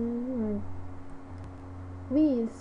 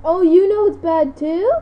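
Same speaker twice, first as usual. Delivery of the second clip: surprised laughing question; 7 semitones higher, 10 dB louder than usual. Real, not acted. Reacting to character's joke.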